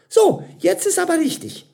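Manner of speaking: very high, loud triumphant call. A narrator's reading. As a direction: announce it brightly as the eager server. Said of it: "rief sie".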